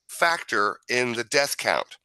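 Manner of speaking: usual speaking voice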